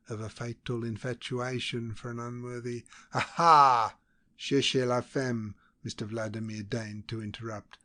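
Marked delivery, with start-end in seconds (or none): 3.1-5.5 a loud, drawn-out Aha, then slow French; knowing jibe. A narrator's reading. Vladimir cutting into Verloc's story, loud slow mockery.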